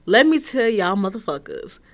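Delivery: ordinary delivery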